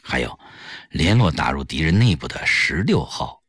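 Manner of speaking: low-pitched secret planning; confidential, deep-voiced instructions; underground comrades, grave. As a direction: lay out the orders in a serious undertone.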